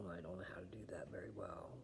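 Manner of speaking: quick whispered aside; hurried half-whisper. Real, not acted.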